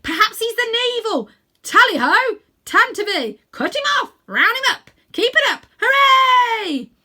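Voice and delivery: very high, loud hunting cries; excited shouting. A narrator's reading. The animals chase Uncle Andrew, shouting with glee. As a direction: yell like a pack giving chase. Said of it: "they shouted".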